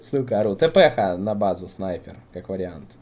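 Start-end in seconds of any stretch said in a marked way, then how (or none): none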